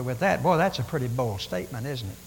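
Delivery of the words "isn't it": normal talking voice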